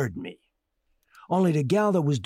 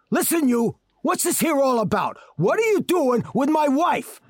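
angry demand; 8 semitones higher, 3 dB louder than usual. Acted. Husband angrily confronts the court.

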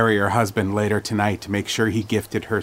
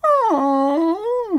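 put-on high whiny old lady oh; old lady whine. A narrator's reading. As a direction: whine it like a disappointed granny. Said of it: "Patty whined".

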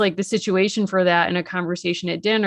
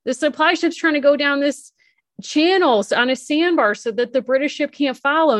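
higher-pitched, excited play-acting; urgent retelling; 8 semitones higher than usual. Girl explaining the supply ship's escape route, urgent. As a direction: blurt out the plan as the girl.

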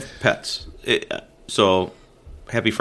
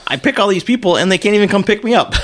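raised, indignant mock griping; high-pitched griping with a laugh; mock complainer; 10 semitones higher, 4 dB louder than usual. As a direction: complain loudly on someone else's behalf, amused. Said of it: "somebody going".

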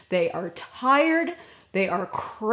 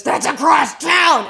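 exasperated shout; 6 semitones higher, 9 dB louder than usual. Retold or imitated. Husband protests the detour.

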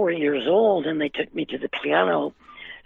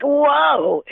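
high, loud, wide-eyed whoa; stunned whoa; 9 semitones higher, 6 dB louder than usual. Retold or imitated. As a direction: gasp it out in awe. Stunned again by the crowd.

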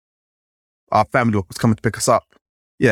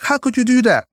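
high, outraged disbelief; shocked classmate; 12 semitones higher than usual. Retold or imitated. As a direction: gasp it out like a scandalised kid. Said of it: "I was like".

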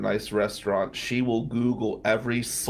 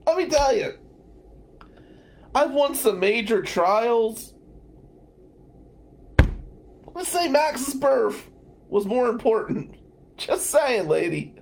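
mock indignation; 10 semitones higher than usual. Real, not acted. Mock-scolding lady about her kids.

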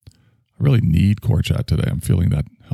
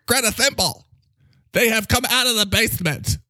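higher, alarmed herald of doom; high, dramatic character voice; 13 semitones higher than usual. Acted. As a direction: announce the critics' invasion in a high, theatrical voice.

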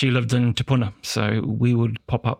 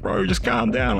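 urging calm; 11 semitones higher than usual. Retold or imitated. Mate calming him.

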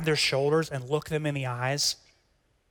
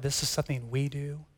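usual speaking voice

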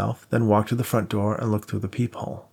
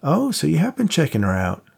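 a hint of a higher, teasing pal; lightly voiced buddy; 6 semitones higher than usual. A narrator's reading. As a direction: play the cocky friend lightly. Voiced friend Max.

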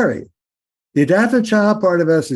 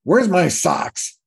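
grumpy rant; 8 semitones higher than usual. Retold or imitated. Bly cranky before birthday party.

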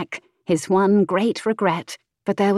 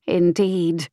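ordinary delivery, nothing marked

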